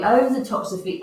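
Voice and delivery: ordinary talk, nothing marked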